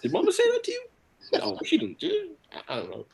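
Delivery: rattled, squeaky-high questioning; panic-tinged denial, pitch leaping up then dropping; hammed-up flustered parent; defensive. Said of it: "I started getting defensive too".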